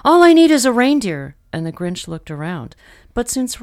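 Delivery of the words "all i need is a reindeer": gleeful excitement. Acted. Grinch gleeful scheming.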